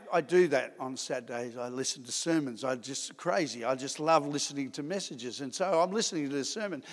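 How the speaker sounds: low, soft personal aside; quiet aside. Real, not acted. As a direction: drop it down, confiding. Aside about listening habits.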